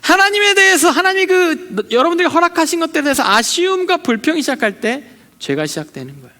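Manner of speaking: ordinary talk, nothing marked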